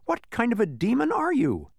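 high-pitched challenging question; indignant. Acted. Milo confronting the small creature, high indignation. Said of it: "said Milo indignantly".

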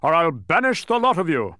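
loud royal threat; thundering king. A narrator's reading. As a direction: thunder out a threat to the whole table. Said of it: "thundered Azaz".

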